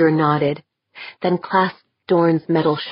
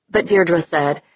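ordinary delivery, nothing marked

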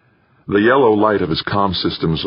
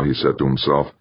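ordinary delivery, nothing marked

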